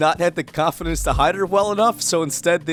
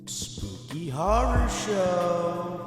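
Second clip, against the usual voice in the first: mock spooky voice; 4 semitones higher, 8 dB quieter, much slower than usual.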